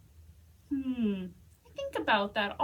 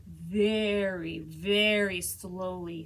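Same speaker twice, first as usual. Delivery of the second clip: drawn-out slow narration; 7 semitones lower, slower than usual. A narrator's reading. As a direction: stretch it out very, very slowly. Narration slows for the words.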